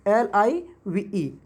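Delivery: एल आई वी ई drawn out letter by letter, very slowly; very slow spelling. Shown as a demonstration. Teacher repeating the spelling for students, very slow. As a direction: stretch every letter of एल आई वी ई. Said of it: "एक होता है एल आई वी ई".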